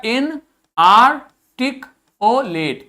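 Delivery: इनआर्टिकुलेट spoken very slowly, syllable by syllable; slow model reading. Shown as a demonstration. Teacher showing the class a new word, slow and deliberate. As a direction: draw out इनआर्टिकुलेट for pupils to repeat. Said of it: "क्या बना word हमारा?".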